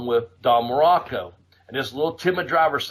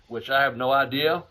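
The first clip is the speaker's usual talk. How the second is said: ordinary delivery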